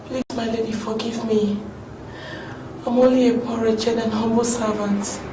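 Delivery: quiet, humble begging; pleading softly. Acted. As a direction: plead with her, barely above a murmur. Servant begs her mistress.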